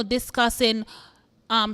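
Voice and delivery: usual speaking voice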